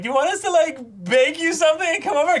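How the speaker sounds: high, falsetto mock parent voice; mock falsetto busybody parents offering to bake; nonsensical parents. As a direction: squeak it out like an overeager parent, laughing. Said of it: "nonsensical parents being like".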